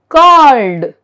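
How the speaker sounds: "called" as one syllable, ending in d; silent E. Shown as a demonstration. Teacher shows E D endings, "called" with silent E. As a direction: say "called" with no vowel before the d. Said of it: "E is silent in the verb".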